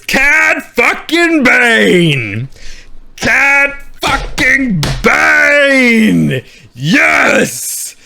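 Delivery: high, hugely drawn-out exultation; triumphant fan cheer. Real, not acted. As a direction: stretch the name out like a victory cry. Fan hails favourite villain's reveal.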